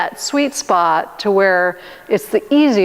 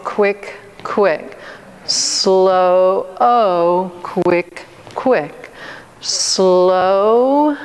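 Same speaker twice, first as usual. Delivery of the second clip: drawn-out slow count; much slower than usual. Shown as a demonstration. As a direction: stretch each "Slow" long, then clip "quick, quick" short. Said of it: "I'll do it one time with you".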